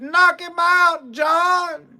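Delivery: high, slow, drawn-out holler of encouragement; urging shout. Retold or imitated. Hunters egging John on from below, high slow holler. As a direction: cheer him on from the bottom of the tree.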